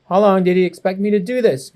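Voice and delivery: plain reading voice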